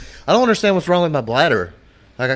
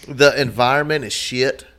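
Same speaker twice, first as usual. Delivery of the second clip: ordinary talk, nothing marked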